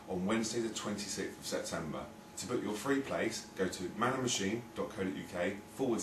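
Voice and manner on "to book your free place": ordinary delivery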